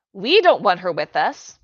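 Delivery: indignant loud complaint; loud protest. Acted. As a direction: protest it loudly. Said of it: "protested Lisa".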